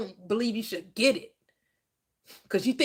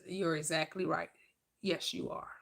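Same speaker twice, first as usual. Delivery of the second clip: lower, much softer, reassuring inner voice; 6 semitones lower, 11 dB quieter than usual. Acted. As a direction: murmur it like the mind answering. Voice of subconscious mind.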